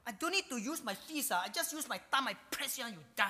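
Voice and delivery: high-pitched cocky taunt; dismissive boast, starting high and easing down; scoffing. Acted. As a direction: scoff at a puny foe. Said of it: "And so we scoff at death".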